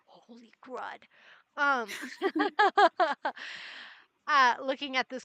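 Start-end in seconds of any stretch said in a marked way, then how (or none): 0.1-1.0 soft, breathless "Holy crud" in awe; awed hush. Acted. Charlie staring wide-eyed at the gold, hushed in awe. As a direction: let it slip out in stunned wonder. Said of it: "just eyes wide, mouth open".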